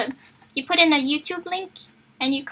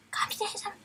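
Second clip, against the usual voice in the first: whispered; 8 semitones higher, 9 dB quieter than usual. Real, not acted. Repeating joke word.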